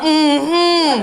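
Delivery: long, emphatic knowing hum; imitating a heartfelt mm-hmm; heartfelt agreement. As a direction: hum it like you feel it deeply. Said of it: "said that shit from the heart".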